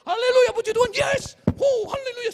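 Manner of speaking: loud, high hallelujah shouts; mock ecstatic praise. Preacher imitating charismatic churchgoers, loud ecstatic praise. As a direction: shout praise like an excited worshipper. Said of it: "kalau orang bilang".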